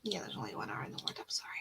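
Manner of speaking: low, hushed muttering to oneself; whispered aside. Real, not acted. Host answering to herself about the one R, whispered aside. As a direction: whisper it quickly under your breath. Muttered self-talk while reading typed messages.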